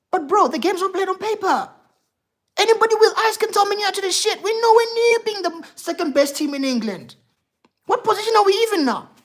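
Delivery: high-pitched, heated protest; real exasperated rant. Real, not acted. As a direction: argue it indignantly to a fellow fan. Exasperated argument about team's standing.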